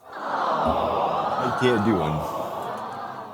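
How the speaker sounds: words dissolving into slow laughter; laughing. Real, not acted. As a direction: laugh through the admission, barely voiced. Laughing at his failed accent.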